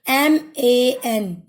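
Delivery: एम ए एन spelled slowly, one letter at a time; slow spelling drill for young learners. Shown as a demonstration. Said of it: "तो ये क्या pronunciation हो गया बेटा".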